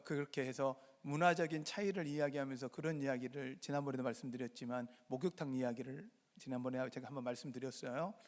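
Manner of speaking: ordinary talk, nothing marked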